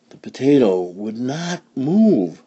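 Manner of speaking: ordinary delivery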